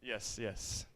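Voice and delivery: ordinary talk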